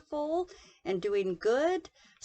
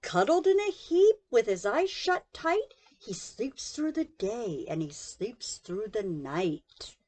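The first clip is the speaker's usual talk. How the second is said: lively picture-book read-aloud; reading to children; 7 semitones higher, 7 dB louder than usual. A narrator's reading. Narrator reading a bedtime story to children, lively. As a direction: tell the bedtime story with big voices.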